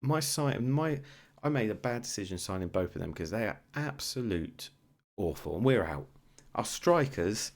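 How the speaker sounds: frustrated rant. Real, not acted. Ranting about strikers after loss.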